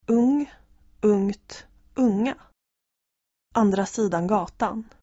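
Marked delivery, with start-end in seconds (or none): none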